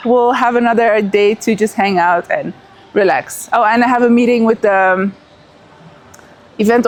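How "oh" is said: normal talking voice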